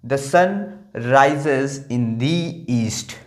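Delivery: "The sun rises in the east" read slowly, "The" as "da", the second "the" as "dee"; English model sentence. Shown as a demonstration. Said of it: "यहाँ पर east आ रहा है". ("The sun rises in the east" धीरे से, पहला "the" दा, दूसरा "दी")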